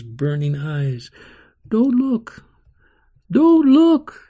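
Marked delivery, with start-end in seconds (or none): 1.7-4.2 high, loud, panicked cry, rising in force; girl crying out in fear; frightened cry. Acted. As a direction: scream it out in terror. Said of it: "she would cry".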